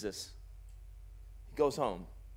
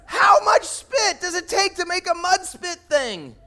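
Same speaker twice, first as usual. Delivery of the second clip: laughing shout; 10 semitones higher, 8 dB louder than usual. Real, not acted. Preacher marvels at the spit miracle.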